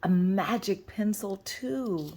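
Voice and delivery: plain reading voice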